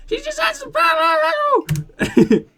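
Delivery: very high, drawn-out comic shout; hammed-up squawking yell, slowed down; blurting fat guy. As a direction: wail it in a silly high voice.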